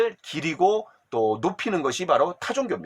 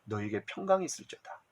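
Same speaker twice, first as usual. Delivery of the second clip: much softer, lower, gentle blessing; Jesus comforting the trembling disciples, soft and tender; 9 semitones lower, 11 dB quieter than usual. Pretend. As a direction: soothe frightened disciples softly. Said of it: "두려워 떨고 있는 것을 알고".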